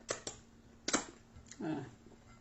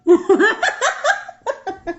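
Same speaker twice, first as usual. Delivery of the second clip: high laugh; 8 semitones higher than usual. Real, not acted. Caught being screenshotted.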